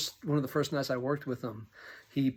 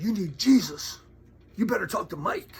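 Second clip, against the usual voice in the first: higher, louder imitation of a furious biker; retold angry outburst; 7 semitones higher, 8 dB louder than usual. Biker shoving a man toward his friend, mock angry shout. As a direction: bark it like an angry man giving orders. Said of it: "he had lost his temper".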